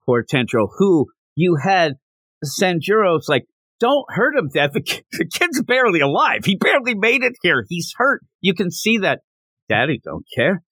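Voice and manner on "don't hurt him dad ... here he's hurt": high-pitched, urgent plea; mock pleading. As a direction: plead for him in an acted high voice. Son pleads with dad for kid.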